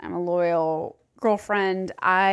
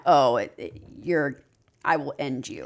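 a quiet, lower promise to end them; low menacing threat; 6 semitones lower, 3 dB quieter than usual. Retold or imitated. To a friend who wronged her, low cold threat. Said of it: "when I'm mad, I'm like".

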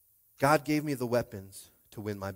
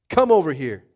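loud, higher yelled summons; yelling; 6 semitones higher, 12 dB louder than usual. A narrator's reading. Goliath daring David to come closer, yelling. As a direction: yell the threat like a giant. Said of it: "Goliath yelled".

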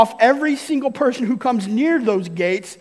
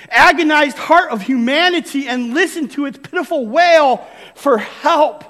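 louder, higher fiery exhortation; William Booth preaching; 5 semitones higher, 5 dB louder than usual. Retold or imitated. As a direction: thunder like an old revival preacher. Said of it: "William Booth ... once said it like this".

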